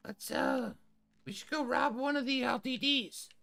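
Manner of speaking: high-pitched tough guy voice; weirdo tough guy. Acted. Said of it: "weirdo tough guy".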